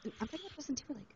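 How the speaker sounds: very soft hushed voice; whispering. Real, not acted. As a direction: whisper so the next room can't hear. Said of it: "I have to whisper".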